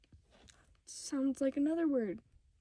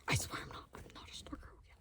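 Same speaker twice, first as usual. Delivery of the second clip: hushed real whisper, protesting; 6 dB quieter, faster than usual. Real, not acted. Insisting to viewers while mom is near, whispering. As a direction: whisper the denial under her breath. She's gonna hear me.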